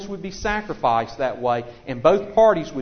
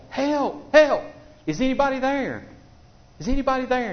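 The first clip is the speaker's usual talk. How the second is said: high, loud calls for help; yelling for help; 11 semitones higher, 4 dB louder than usual. Retold or imitated. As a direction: shout for help from the cliff edge. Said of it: "So he started yelling for help".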